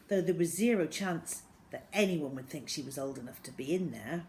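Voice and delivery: ordinary delivery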